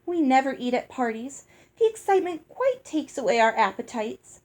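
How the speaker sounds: a hint of a prim older sister; firm interruption. A narrator's reading. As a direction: cut in firmly but politely. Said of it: "her sister interrupted firmly".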